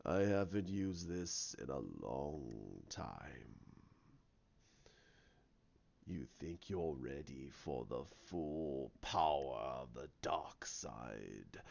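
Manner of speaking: slow, deep, hushed villain; Snoke. Retold or imitated. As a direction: draw it out in a low menacing murmur. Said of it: "He goes".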